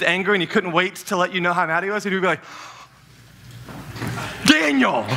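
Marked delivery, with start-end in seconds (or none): 4.5-5.1 sharp high call of the name; dad yelling. Retold or imitated. Son imitating his angry dad calling him.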